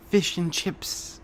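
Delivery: slow, high British caricature; mock British accent. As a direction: ham up a silly British accent, drawn out. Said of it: "things British people say".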